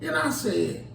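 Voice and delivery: ordinary talk, nothing marked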